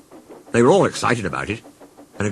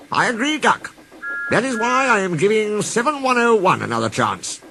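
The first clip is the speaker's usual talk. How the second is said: higher, calm boss voice; lightly voiced kindly manager; Fat Controller; 11 semitones higher than usual. A narrator's reading.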